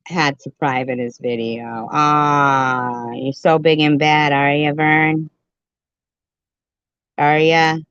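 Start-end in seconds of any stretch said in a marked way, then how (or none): 1.9-7.8 louder, jeering challenge; mocking taunt. Real, not acted. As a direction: taunt a so-called tough guy. Taunting Vern as big and bad.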